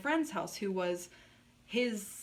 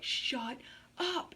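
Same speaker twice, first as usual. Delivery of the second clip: high drawn-out shut up; snapping shut up; 6 semitones higher than usual. Retold or imitated. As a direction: snap it sharply, rising. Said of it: "literally like".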